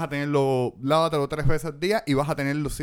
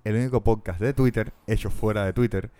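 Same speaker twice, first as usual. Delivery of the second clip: podcast intro; 5 semitones lower than usual. Acted. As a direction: announce the show like a host. Host's show intro.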